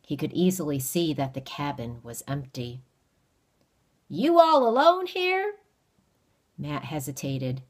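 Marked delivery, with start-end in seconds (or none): 4.1-5.5 high, loud, prying backwoods voice; probing stranger. Acted. Stranger peering into the empty cabin, prying question. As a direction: check whether the boy is alone.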